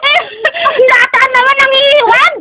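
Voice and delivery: crying out while being tickled; squealing through tickles. Real, not acted. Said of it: "kinakikiliti ako!".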